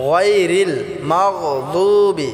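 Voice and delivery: slow "গায়রিল মাগযুবি", thick gh and long heavy du; full-mouthed Arabic "গায়রিল মাগযুবি"; heavy, drawn daad. Shown as a demonstration. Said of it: "দুটা আমরা এক আলিফ টেনে পড়ব".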